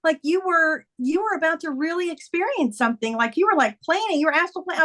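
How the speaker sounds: lightly voiced daughter making a discovery; daughter's insight. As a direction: pitch it up as she explains. Quoting daughter's excited insight.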